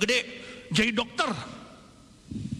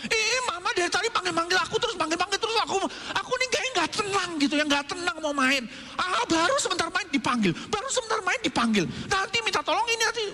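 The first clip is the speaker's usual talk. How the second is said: put-on much higher, fast complaining child voice; grumbling kid; 10 semitones higher, faster than usual. Child annoyed mom keeps calling, high fast grumbling.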